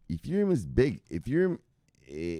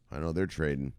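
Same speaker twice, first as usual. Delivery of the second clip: normal talking voice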